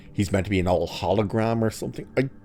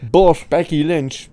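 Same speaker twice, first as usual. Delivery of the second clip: Becky Lynch said slow, higher and louder; a drawn-out announcement of the surprise name; emphatic reveal; 8 semitones higher, 6 dB louder, slower than usual. Real, not acted. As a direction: land the name with weight. Announcing surprising casting news.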